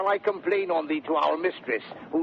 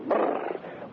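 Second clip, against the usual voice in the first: unvoiced shivering brrr with chattering lips. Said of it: "their teeth chattering".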